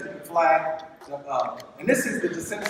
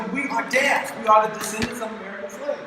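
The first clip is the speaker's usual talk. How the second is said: usual speaking voice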